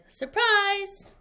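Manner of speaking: spring the surprise on her; mock party cheer; party shout.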